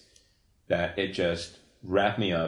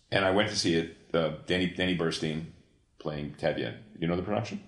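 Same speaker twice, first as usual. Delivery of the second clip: ordinary talk, nothing marked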